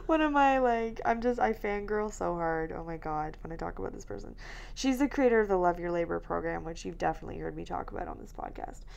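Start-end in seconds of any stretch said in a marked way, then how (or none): none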